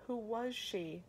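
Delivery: high, pleading question; urgent plea to the harbor master; desperate begging. A narrator's reading. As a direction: beg for the answer, a touch desperate. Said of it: "I begged desperately".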